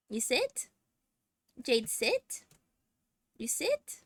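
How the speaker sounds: high questioning coax to the cat; pet talk. Real, not acted. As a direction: gently ask the cat to sit. Coaxing cat to sit.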